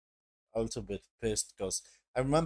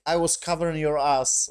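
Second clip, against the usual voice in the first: mildly raised voice, imitating an angry protest; retold scream; 4 semitones higher, 6 dB louder than usual. Ellie protesting to Joel in a retold argument, mild mock scream. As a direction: retell a scream at half force. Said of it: "Ellie was screaming to Joel".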